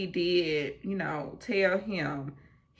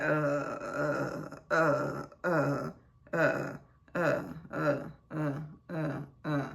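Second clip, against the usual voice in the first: lower, halting uh uh; imitating stammer; 3 semitones lower, slower than usual. Mocking Kenny stammering when caught, halting uh's. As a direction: mimic a man stumbling for words. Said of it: "He stamper like".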